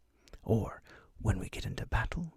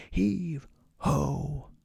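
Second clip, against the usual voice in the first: captain's call; 6 semitones higher, 5 dB louder than usual. Pretend. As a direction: heave ho called out, voiced and louder. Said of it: "And the captain might say".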